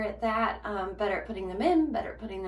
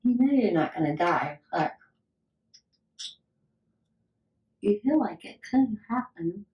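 speaking around fingers; fingers in mouth; 4 dB louder than usual. Real, not acted. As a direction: hand pulling at lips. Seen 5: Fingers in mouth only from 14.4s on; earlier she talks to camera.

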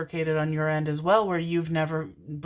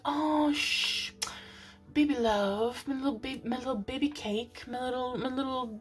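put-on condescending baby talk; 7 semitones higher than usual. Mimicking people patronizing someone upset, condescending baby talk. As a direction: coo as if soothing a baby, patronizingly. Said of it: "people can be very condescending".

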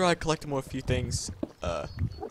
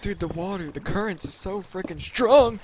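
louder, higher, stretched-out whine; strained, raised-voice protest; exasperated complaint; 5 semitones higher, 6 dB louder than usual. Real, not acted. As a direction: complain loudly, dragging the words out. Struggling against water in game.

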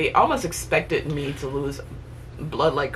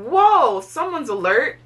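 mock surprised doctor; 10 semitones higher, slower than usual. Quoting doctor's surprise in theatre.

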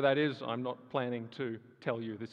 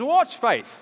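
mock Peterson question; 10 semitones higher, 12 dB louder than usual.

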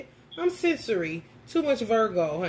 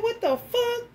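shocked outburst; 12 semitones higher than usual. Retold or imitated. The bug survives the Lysol, shocked high outcry. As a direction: jump up in disbelief at it. Beetle still moving after spray.

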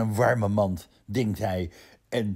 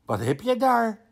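hoog hondenstemmetje; 11 semitones higher than usual. Acted. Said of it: "vraagt Rientje".

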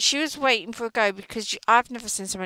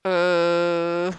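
stunned uh; 7 dB louder than usual. Retold or imitated. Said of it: "I was like".

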